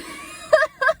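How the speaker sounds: high, bursting cackle of laughter. Real, not acted. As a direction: crack up helplessly at your own story. Cracks up at own fart story.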